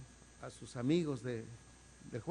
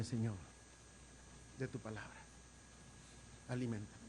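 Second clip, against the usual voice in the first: soft, lower, prayerful plea; hushed prayer; 4 semitones lower, 14 dB quieter than usual. Acted. Preacher praying to God before the church, hushed. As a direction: drop to an intimate prayer. Whispered prayer after the sermon.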